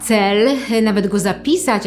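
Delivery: usual speaking voice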